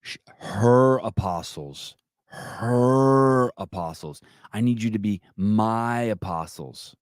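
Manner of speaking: slow, drawn-out mock preacher voice; mocking the governor. Host mocks the governor's apostles plea, slow mock sermon. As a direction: parody her sermon, stretching each word.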